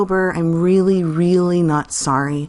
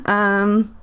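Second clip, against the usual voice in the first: laughing; 5 semitones higher than usual. Real, not acted. Laughing at name.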